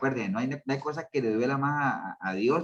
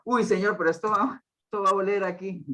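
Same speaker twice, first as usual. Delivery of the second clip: higher, mock-disgusted plea, a touch hammed-up; lightly voiced Martha recoiling from the smell; Martha's squeamish protest; 6 semitones higher than usual. Pastor voicing Martha's protest at the tomb, mock-disgusted.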